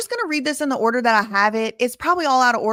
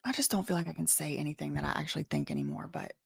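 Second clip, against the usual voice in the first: quiet; 5 semitones lower, 12 dB quieter, faster than usual. Real, not acted. Sighing, admitting she feels silenced.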